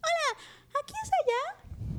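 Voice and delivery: voz de niñita de títere mucho más aguda; voz de niña. Retold or imitated. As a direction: pregunta con vocecita de niña inocente, muy lento al final. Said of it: "animen esta voz". (vocecita aguda de niña de Plaza Sésamo)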